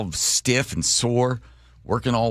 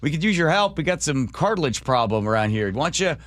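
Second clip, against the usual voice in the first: friendly cells; 7 semitones higher than usual. Pretend. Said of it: "all the other cells are like".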